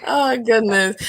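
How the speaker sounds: usual speaking voice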